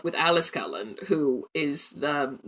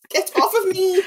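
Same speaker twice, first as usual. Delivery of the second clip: laughing protest; 14 semitones higher, 3 dB louder than usual. Real, not acted. Dog licking her face.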